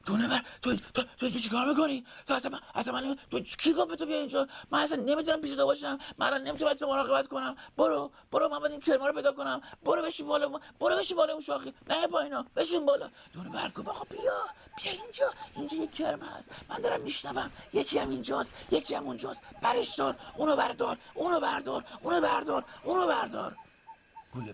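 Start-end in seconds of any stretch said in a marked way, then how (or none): 0.1-13.1 high, fast, breathless scolding; high-pitched panicky young owl; panting, flustered owlet. Acted. 13.9-23.6 very high, whispery squeak; tiny high-pitched owl giving urgent orders; urgent little owlet. Acted. Little owl urgently directs brother.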